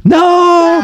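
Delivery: high, drawn-out "no"; hammed-up movie wail; mock anguished cry. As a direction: cry it out high like a film hero. Mock cry while retelling film scene.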